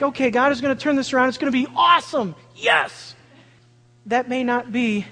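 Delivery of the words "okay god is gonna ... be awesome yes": mock excitement. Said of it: "you think".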